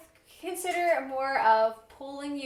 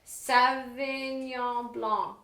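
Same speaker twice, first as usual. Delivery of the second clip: slow, careful French pronunciation; French name, stretched syllable by syllable; attempted French accent; much slower than usual. Real, not acted. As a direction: draw out the wine name in amateur French. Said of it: "Your French is not nearly as good".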